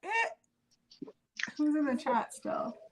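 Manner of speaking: usual speaking voice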